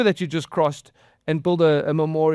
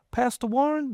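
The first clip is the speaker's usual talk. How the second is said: lightly imitated southern accent, pitched high; 8 semitones higher than usual. Son phoning his pastor in grief, imitated southern accent. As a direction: call out a name in a mild southern accent. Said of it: "with a southern accent".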